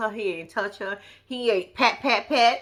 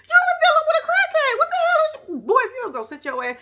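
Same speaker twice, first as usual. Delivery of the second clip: much higher and louder, incredulous; exasperated outburst; 4 dB louder than usual. Real, not acted. Telling TC he knew about the crackhead, incredulous yell. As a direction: blurt it out in disbelief.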